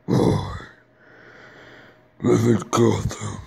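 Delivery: gruff, slow superhero voice, pushed out in short phrases; Batman. Acted. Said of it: "I'm gonna do my Batman impression".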